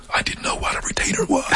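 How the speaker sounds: breathy, whispered confession; hushed, unvoiced admission; whispered aside. Real, not acted. Confiding aside inside his story.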